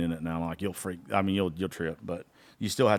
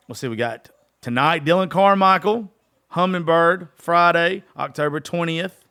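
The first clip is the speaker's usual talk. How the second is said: louder, higher hype read of show dates; promo announcement; 4 semitones higher, 5 dB louder than usual. Acted. Singer plugging his upcoming concerts to fans, hyped promo read. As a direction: pump the crowd up for the shows.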